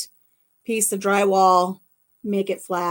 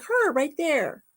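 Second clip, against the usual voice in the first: high eager request; 6 semitones higher than usual. Retold or imitated. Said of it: "I'm like".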